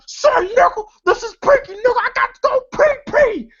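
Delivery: very high-pitched whining call; mock desperate plea. Pinky outside the bathroom begging to get in, high mock plea. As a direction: plead urgently way up high, hammed-up.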